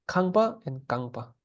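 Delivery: kangpa with a breathy puff on the k, then kangpa with a plain k; aspirated then unaspirated kangpa. Shown as a demonstration. Teacher contrasting house and leg, kangpa aspirated then plain. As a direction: contrast the two kangpa, first k aspirated, second not. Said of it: "with aspiration is house".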